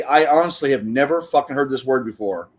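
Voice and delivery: normal talking voice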